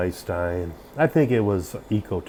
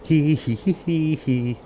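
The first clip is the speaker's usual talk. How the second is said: high snickering hee hee; Cartman laugh; 8 semitones higher, 4 dB louder, slower than usual. Retold or imitated. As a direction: snicker high like a cartoon kid. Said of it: "Sorry, I act like Cartman".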